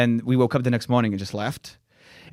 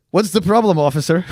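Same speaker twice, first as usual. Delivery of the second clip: nonchalant; 5 semitones higher, 6 dB louder than usual. Retold or imitated. Casual driver facing armed police.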